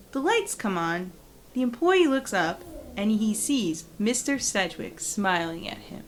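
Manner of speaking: ordinary delivery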